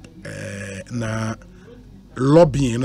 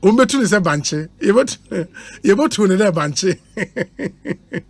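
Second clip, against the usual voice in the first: laughing saying; 4 semitones higher, slower than usual. Real, not acted. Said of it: "I say".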